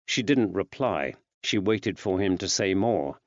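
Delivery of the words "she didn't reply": plain reading voice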